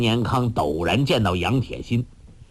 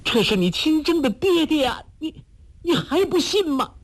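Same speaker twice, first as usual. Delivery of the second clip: very high, pleading outcry; mother crying out to stop her son's spear; desperate cry. A narrator's reading. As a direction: cry out in anguish. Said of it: "包希若叫道".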